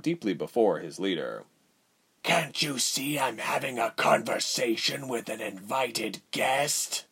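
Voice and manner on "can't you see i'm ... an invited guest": rasping, annoyed ruler; raspy voice. A narrator's reading. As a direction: rasp out the annoyed rebuke. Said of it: "a raspy voice returned".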